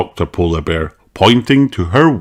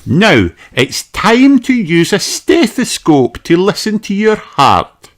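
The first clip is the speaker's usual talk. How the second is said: high, friendly doctor; kindly doctor greeting a child; Doctor Polar Bear voice; 5 dB louder, slower than usual. Acted.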